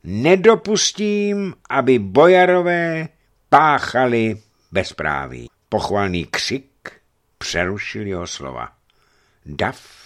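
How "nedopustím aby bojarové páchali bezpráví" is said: high, loud, slow vow; rising voice. A narrator's reading. The bishop promises the crowd justice, rising voice. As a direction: raise the old voice to a firm vow. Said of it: "Hlas vladyku zesílil".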